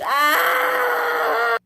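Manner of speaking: long, very high held scream. Acted. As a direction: shriek and hold the note. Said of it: "he screamed".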